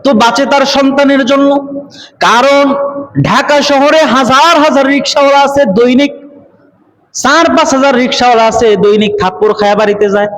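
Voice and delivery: high-pitched sermon delivery; intoned Bengali sermon; impassioned preaching. Acted.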